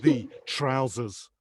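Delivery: slow, deliberate cookery instruction; step-by-step chef orders, pausing on each phrase; Gordon Ramsay. Acted. Famous chef talking [name] through a pee, slow instruction. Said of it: "visions of him telling me what to do".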